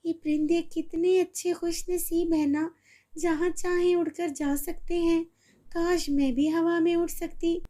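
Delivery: put-on high little girl voice, dreamy wish; longing to fly like the birds; girl in a wheelchair watching birds, wistful.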